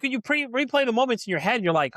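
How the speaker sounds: ordinary talk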